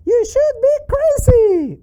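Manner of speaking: very high, loud puppet outburst; piping cartoon exclamation; squeaky puppet. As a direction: blurt it as a cheeky squeaky puppet. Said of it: "you don't have to say that".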